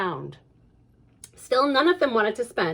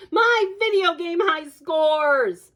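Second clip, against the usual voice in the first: dismayed cry; 7 semitones higher, slower than usual. Acted.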